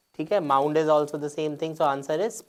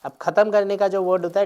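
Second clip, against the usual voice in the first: normal talking voice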